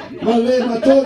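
ordinary talk

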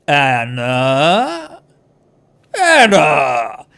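drawn-out, loud cutting interruption; cutting call. Acted. Feyrod cutting through the girl's speech, stern drawn-out call. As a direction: cut through her speech with the name. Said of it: "Feodor's voice is quiet, but it cuts through".

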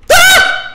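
sudden high, loud shriek; startled scream. Real, not acted. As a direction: scream as if jumped. Another jump scare.

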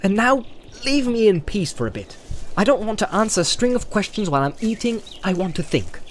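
high, exasperated brush-off; irritated plea for some peace; sharp. A narrator's reading. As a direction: tell him off testily for pestering. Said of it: "said Frodo sharply".

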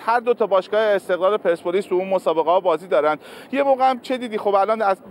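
ordinary talk, nothing marked

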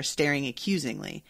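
ordinary delivery

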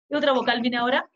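ordinary talk

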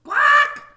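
high, loud startled quack; loud duck quack. A narrator's reading. Duck startled by the hamster, loud quack. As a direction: blast it out like a panicked duck.

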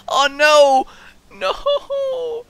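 high loud yelp of alarm; alarmed cry. Real, not acted. Gamer crying out as the dog growls, alarmed. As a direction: cry out in dismay.

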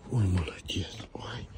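whispered praise; breathy whispered praise to dog; whispering. Real, not acted.

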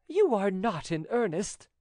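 high heroine voice, mild disbelief; disbelieving Angelique. Acted. Angelique doubts his proposal.

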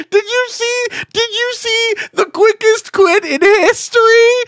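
high, loud incredulous yell; excited shout. Real, not acted. Streamer asking chat about the quickest quit, excited high shout. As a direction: yell it out in disbelief.

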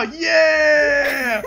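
loud, crying out in triumph; triumphant yell. Real, not acted. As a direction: yell it out like you just made it in. Triumphant yell getting inside.

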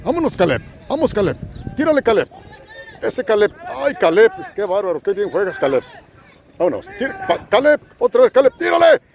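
climbing, frantic play-by-play; excited cheering. Acted. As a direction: cheer Caleb on like a commentator. Cheering on Caleb live.

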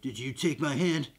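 low-key acted question; Rick questioning zombie. Rick asks the zombie about his hand, low-key questioning. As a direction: put it to the zombie evenly. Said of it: "He's like, "Zombie".

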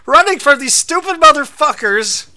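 loud, high-pitched furious cursing; real exasperated rage; angry yelling. Real, not acted. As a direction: spit it out at a high shout. Angry at game.

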